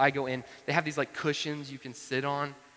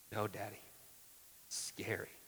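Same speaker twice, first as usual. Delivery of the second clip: put-on small, soft child's refusal; hushed, understated toddler voice; scared toddler, retold; 4 semitones lower, 12 dB quieter than usual. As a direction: lightly imitate a timid little girl, quietly. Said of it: "she can't really say ours".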